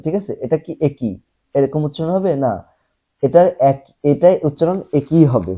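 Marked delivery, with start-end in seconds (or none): none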